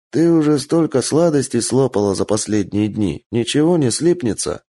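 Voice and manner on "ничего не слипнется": usual speaking voice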